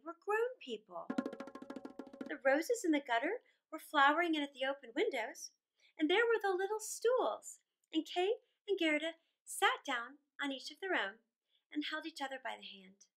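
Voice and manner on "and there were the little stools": plain reading voice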